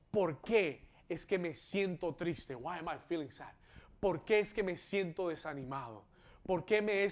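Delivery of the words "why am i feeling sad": ordinary talk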